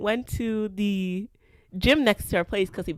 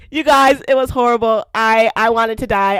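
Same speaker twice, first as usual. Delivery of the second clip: "You guys" and "horrible" burst out much louder; loud exclaiming; 2 semitones higher, 9 dB louder than usual. Real, not acted. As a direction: blurt it out loud, reliving the horror. Reliving an awful first kiss.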